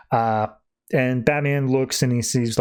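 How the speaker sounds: normal talking voice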